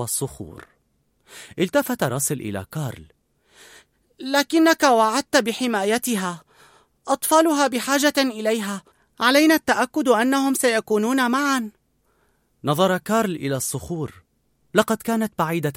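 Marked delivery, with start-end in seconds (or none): 4.2-11.7 put-on high child's voice, pleading; a boy reminding the old man of a promise; higher child voice, mildly insistent. A narrator's reading. Narrator voices Russell pleading.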